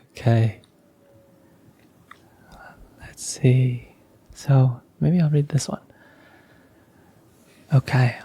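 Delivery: usual speaking voice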